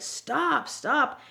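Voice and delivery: high-pitched urging to stop; urgent retold plea. Boss pleading with her to stop hitting herself, urgent.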